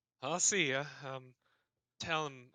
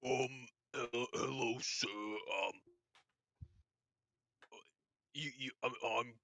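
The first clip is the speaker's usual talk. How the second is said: halting, slow orc voice; timid orc groping for words; nervous stuttering orc; 4 dB quieter, slower than usual. Acted. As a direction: stammer shyly through the greeting. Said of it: "he's j-, he's stuttering".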